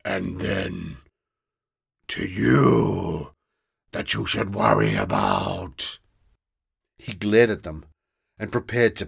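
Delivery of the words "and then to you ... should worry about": slow, loud, dragged-out monster voice; a small green monster spelling out the accusation slowly; menacing monster. Acted. Grobble threatens.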